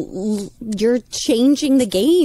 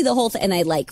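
usual speaking voice

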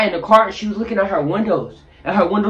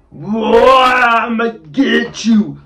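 slow, louder menacing threat; menacing villain voice; 5 semitones higher, 5 dB louder, much slower than usual. Retold or imitated. House owner threatening the narrator, slow menacing. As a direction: drag it out like a movie villain. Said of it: "he was like".

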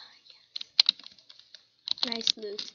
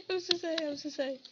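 quick, high-pitched apology between laughs; laughing apology; 5 semitones higher, much faster than usual. Real, not acted. Player apologising during a laughing fit, breaking up. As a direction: blurt it out mid-giggle.

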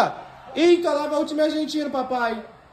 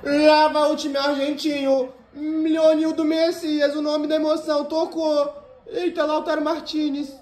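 play-by-play commentary; 4 semitones higher than usual. Acted. Mock match play-by-play.

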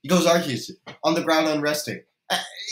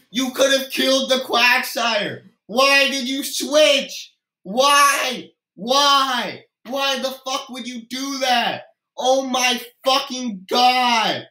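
exasperated yelling; 7 semitones higher, slower than usual. Real, not acted. Ranting at player's baffling switch.

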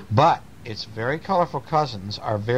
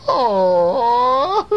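high, loud mock crying; long, high boo-hoo howl; hammed-up wail; 8 dB louder than usual. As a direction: wail like an upset baby bear. Baby bear wails at broken chair.

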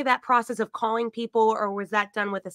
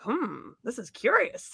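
slow, softer musing; retold puzzlement; 6 dB quieter, slower than usual. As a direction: draw out a pondering hum, intrigued. Retelling own puzzled reaction.

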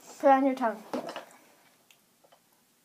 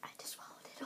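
whispering; 22 dB quieter, much faster than usual. Real, not acted. Confessing after tasting food.

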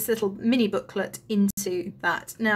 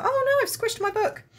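surprised; 14 semitones higher than usual. Real, not acted. Squished her book by accident.

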